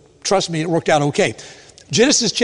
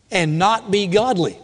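normal talking voice

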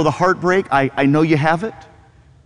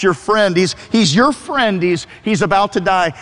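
usual speaking voice

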